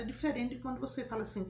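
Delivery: ordinary talk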